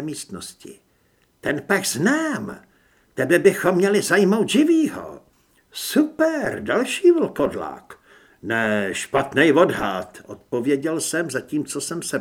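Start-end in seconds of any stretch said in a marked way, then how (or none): 1.4-8.0 high, loud enemy woman; high-pitched villainess, drawn-out words; woman's voice. A narrator's reading. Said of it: "Byl to ženský hlas". 8.4-10.2 loud, slow retort; forceful answer in his own low register; defiant hero. A narrator's reading.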